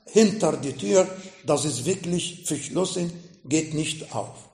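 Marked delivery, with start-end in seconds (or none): none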